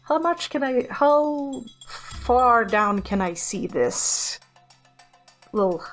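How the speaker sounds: ordinary delivery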